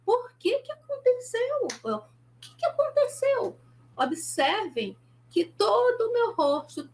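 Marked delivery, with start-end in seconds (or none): 0.0-3.6 sharp, much higher angry demand; angry demo, tense and high. Shown as a demonstration. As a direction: demand it angrily, pitch shooting up. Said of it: "Fique com raiva".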